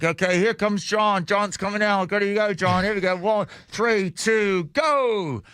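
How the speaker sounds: high-pitched mock countdown call; imitating an upbeat walkie-talkie announcement; walkie-talkie announcer. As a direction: call it out like a jump operator on the radio. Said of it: "He's got this walkie-talkie".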